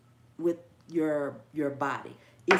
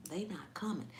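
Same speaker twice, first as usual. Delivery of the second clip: whispered punchline; 10 dB quieter than usual. Real, not acted. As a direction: whisper it, much softer.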